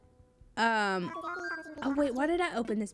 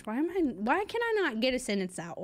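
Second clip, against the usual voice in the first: ordinary delivery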